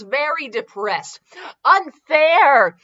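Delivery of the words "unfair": put-on loud, high childish whine; hammed-up kid protest; mock whiny kids. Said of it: "the kids whined angrily".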